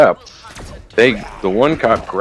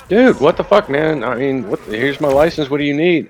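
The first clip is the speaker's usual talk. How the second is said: higher-pitched, bewildered protest in retelling; retold alarmed protest; 6 semitones higher than usual. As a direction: act out the driver pleading his case. Retold driver protests to cop.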